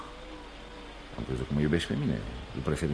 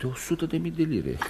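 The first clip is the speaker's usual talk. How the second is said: laughing; 11 semitones higher, 4 dB quieter than usual. Acted. Joking, running for the bus.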